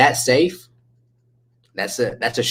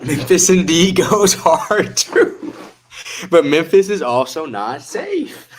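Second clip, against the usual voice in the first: laughing; 6 semitones higher, slower than usual. Real, not acted. Laughing while telling about Memphis.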